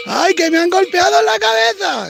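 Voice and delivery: high whiny fake wail, hammed-up; mock crying. Mocking protesters who got hit, with a high fake wail. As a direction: wail like a crybaby pretending to be hurt.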